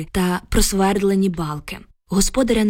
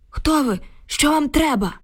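put-on high, alarmed old man's exclamation; startled cry; 7 semitones higher than usual. A narrator's reading. Frightened old man finds strangers in his yard, startled cry. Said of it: "від несподіванки скрикнув".

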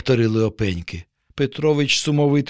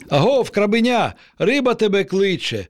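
hoarse call; 7 semitones higher than usual. A narrator's reading. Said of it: "прохрипів він".

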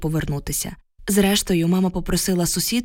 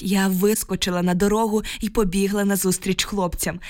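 usual speaking voice

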